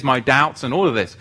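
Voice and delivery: usual speaking voice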